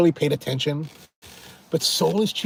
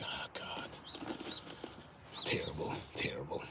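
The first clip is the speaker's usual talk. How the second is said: whispered, much softer dismay; hushed dread; 11 semitones lower, 20 dB quieter than usual. Pretend. To viewers, imagining pricey hotels, whispering in dread. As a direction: breathe it out like a grim secret.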